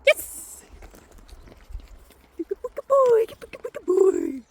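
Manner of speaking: high, loud praise; squeaky praising a dog. Real, not acted. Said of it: "I could have been more enthusiastic".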